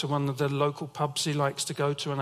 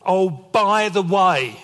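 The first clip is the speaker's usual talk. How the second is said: slow, louder drawn-out warning; dad's ominous tone; 3 semitones higher, 9 dB louder, much slower than usual. Retold or imitated. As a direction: lean on "by" like a stern father. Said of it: "I've heard that phrase with that tone".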